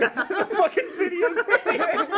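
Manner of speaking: high, slow, laughing through words; suppressed giggles. Real, not acted. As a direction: squeeze the line out between giggles. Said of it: "And I'm like".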